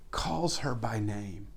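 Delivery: whispered, slowed words; hushed, slow whisper at the key moment. Real, not acted. Tender climax of the story.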